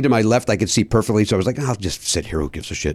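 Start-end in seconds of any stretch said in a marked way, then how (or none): 1.6-2.9 much lower and softer, shrugging it off; under-the-breath whatever; resigned mutter. Retold or imitated. Inner resigned thought.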